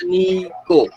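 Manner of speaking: "Mecánico" split into me, ca, ni, co; syllable by syllable. Shown as a demonstration. Teacher shows class how to separate the word, "Mecánico" syllable by syllable. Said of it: "la separo".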